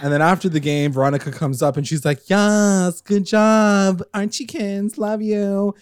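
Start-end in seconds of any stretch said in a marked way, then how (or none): 2.3-5.7 high-pitched lightly mocking cheer; higher-pitched fan gush; gushing congratulations. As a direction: imitate a girlfriend gushing, drawn out. Imitating Veronica congratulating them.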